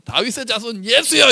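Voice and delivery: high-pitched mock shout; crying out to Jesus. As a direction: act out a plea called from the roadside, without full volume. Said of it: "이렇게 소리 질러요". (높은 음으로 외치는 흉내)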